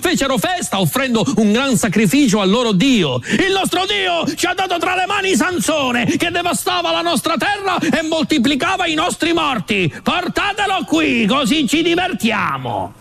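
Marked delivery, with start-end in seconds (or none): 3.2-12.9 higher, festive crowd gloat; lightly voiced jeering revellers; gloating Philistines. Philistines celebrating Sansone's capture at the feast, gloating.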